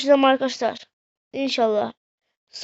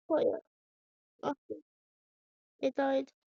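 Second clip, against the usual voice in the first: dejected murmur; 14 dB quieter than usual. Real, not acted. Sad after losing the cup.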